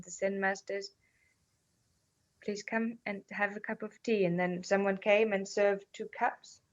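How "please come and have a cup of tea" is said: soft, gentle, hushed invitation; a hint of a serene old teacher; calm Zen master. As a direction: offer the tea quietly and unhurried. Calm Zen master in retold story.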